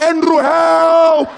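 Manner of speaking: very high, loud, slow cry; desperate call for help. Retold or imitated. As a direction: drag out a terrified plea. Child calling for help.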